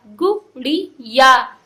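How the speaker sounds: "गुड़िया" very slow and loud, split into syllables. Shown as a demonstration. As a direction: stretch "गुड़िया" out as three separate beats. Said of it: "तो इसको ऐसे पढ़ेंगे".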